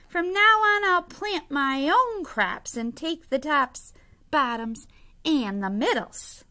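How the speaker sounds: loud, high character holler, stretching the words. A narrator's reading. Bear tells Hare he'll plant his own crops, hollering. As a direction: holler it like a storybook bear laying down the law. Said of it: "he hollered".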